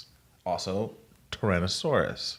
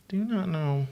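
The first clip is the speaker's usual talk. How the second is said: incredulous; 10 semitones higher than usual. Retold or imitated. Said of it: "I go".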